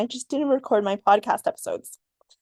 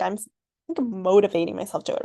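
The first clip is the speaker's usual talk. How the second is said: usual speaking voice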